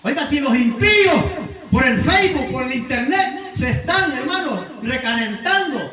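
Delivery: loud, high, rousing sermon voice; full-force preacher calling the flock to God; fiery preaching. Acted. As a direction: preach it out to the whole church. Preacher exhorting congregation loudly.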